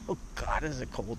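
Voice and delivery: shivering from icy water. Real, not acted. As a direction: talk while shivering with cold. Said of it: "I'm actually shivering right now".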